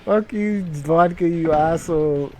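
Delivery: ordinary delivery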